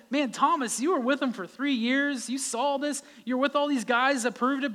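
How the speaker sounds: chiding Thomas. Retold or imitated. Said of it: "we could all say".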